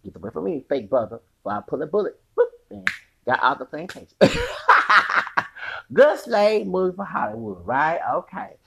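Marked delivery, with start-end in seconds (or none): none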